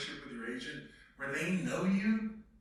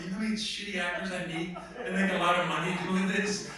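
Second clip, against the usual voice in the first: ordinary talk, nothing marked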